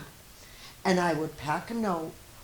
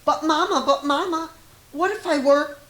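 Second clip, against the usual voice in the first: put-on high child voice, insistent; a little kid begging Mama with big what-ifs; high, pleading little child; 11 semitones higher, 8 dB louder, faster than usual. A narrator's reading. Child voice in read picture book.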